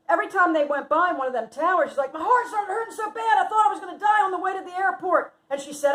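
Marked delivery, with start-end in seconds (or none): 2.1-5.3 high, loud, agitated retelling; dramatic recounting. Retold or imitated. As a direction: imitate a friend dramatizing her scare. Friend dramatizes her scare in car.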